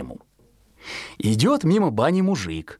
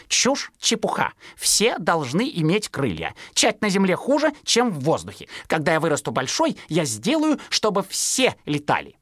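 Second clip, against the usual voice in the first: higher cocky chick voice; higher brash baby bird; scornful fledgling; 6 semitones higher than usual. Acted. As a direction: scoff like a know-it-all sparrow. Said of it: "сказал Пудик".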